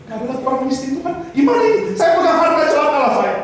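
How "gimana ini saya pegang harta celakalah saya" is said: a high, hammed-up wail of alarm; mock panic. The last man, stuck holding everyone's wealth, in mock panic.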